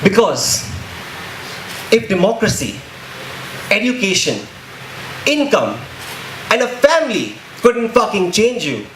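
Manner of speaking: spoken-word poem. Acted. Poet accusing those who want blood, impassioned spoken word. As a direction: perform it as a driving, emphatic protest poem.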